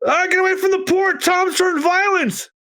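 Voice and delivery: frightened bystander screaming a warning; panicked crowd. Acted. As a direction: shriek it like a fleeing dockworker. Panicked crowd in manga read-aloud.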